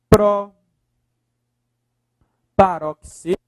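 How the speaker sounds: "pró-paroxítona" with a loud PRÓ, long pause, then the rest; very slow, segmented "pró-paroxítona"; broken into parts. Shown as a demonstration. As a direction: punch PRÓ and hold back "paroxítona". Teacher builds the term in pieces.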